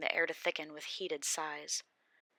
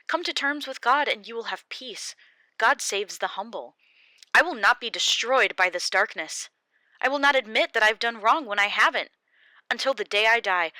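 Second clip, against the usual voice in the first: loud, higher, furious refusal; defiant Job; 5 semitones higher, 9 dB louder than usual. Acted. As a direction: stand your ground and shout it.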